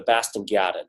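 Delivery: "Boston Garden" with dropped R's in a Boston accent. Shown as a demonstration. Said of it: "how they say it with the accent".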